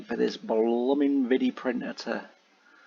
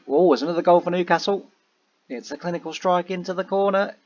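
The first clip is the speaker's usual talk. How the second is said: excited; 6 semitones higher, 4 dB louder, faster than usual. Real, not acted. Goal scored in the game.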